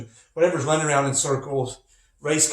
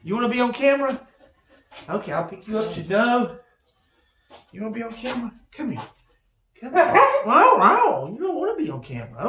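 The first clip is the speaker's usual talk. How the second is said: higher coaxing pet voice; high dog talk; 6 semitones higher, faster than usual. Real, not acted. Teacher coaxing Misty to be on camera, high and sweet. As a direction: coax the dog, light and high.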